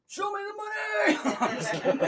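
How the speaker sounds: high, slow, laughing movie shout; Jerry Maguire. Retold or imitated. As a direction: belt it like a movie line. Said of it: "I'm gonna pull a Jerry Maguire on you".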